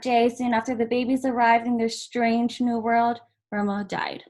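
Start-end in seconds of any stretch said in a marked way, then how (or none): none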